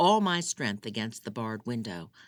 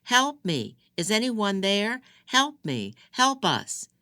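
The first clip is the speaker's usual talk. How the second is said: much higher, loud cries for help; yelling for help; 9 semitones higher, 8 dB louder, slower than usual. Acted. Trapped wife yelling through barred window for help, loud high cries. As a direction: scream for anyone to hear you. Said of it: "As I yelled".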